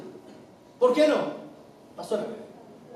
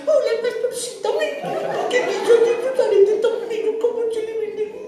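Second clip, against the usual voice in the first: put-on high, laughing baby talk, higher; mock baby talk; 9 semitones higher than usual. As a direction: gush like to an infant, joking. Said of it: "le digo a la pastora Andrea". (saludando a la pastora con voz aguda de bebé, riendo)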